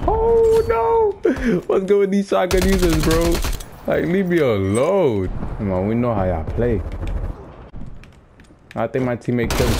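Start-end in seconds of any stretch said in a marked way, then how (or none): 0.1-5.3 high wailed "Oh no", then high-pitched griping; exasperated high-pitched gamer whine; dismayed protest. Real, not acted. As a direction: wail first, then complain in a raised pitch. Killed by shotgun users, protesting.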